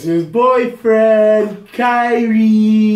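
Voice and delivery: slow, high, loud mock announcement; teasing. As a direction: drag it out teasingly. Teasing punchline.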